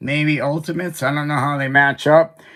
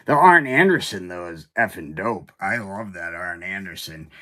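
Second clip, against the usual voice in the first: usual speaking voice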